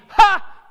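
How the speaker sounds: loud, high scornful "Ha!" bark; a scoffing laugh at pride; mocking laugh.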